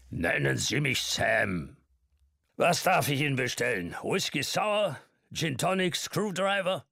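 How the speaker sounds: put-on high, lively old man offering drinks; jovial old man. A narrator's reading. Old man offering Mark drinks, jovial and high. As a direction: rattle off the drink list with gusto.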